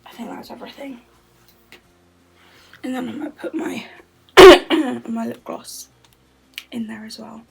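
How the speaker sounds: soft unvoiced whisper throughout. Real, not acted. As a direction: keep it hushed, barely voiced. Quiet whispered chat at home.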